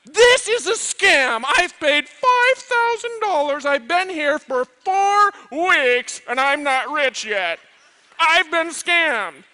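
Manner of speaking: the irate student yelling slowly at his professor about being scammed; angry student. Retold or imitated. An angry student shouting at the professor that he isn't rich yet. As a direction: drag out every word in a furious shout. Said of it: "he looks at you and he goes".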